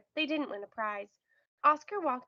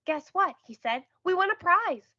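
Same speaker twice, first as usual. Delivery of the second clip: raised, louder, eager announcement; bursting to share the win; excited news; 7 semitones higher, 7 dB louder than usual. Acted. Oscar phones Emmy with news of the prize, excited. Phoning Emmy about winning.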